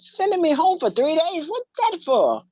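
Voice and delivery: high, loud disbelief; shocked kid. Retold or imitated. Sixth grader stunned at the principal's words, high and loud. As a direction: echo it back, stunned. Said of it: "I said".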